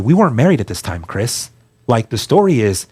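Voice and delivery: normal talking voice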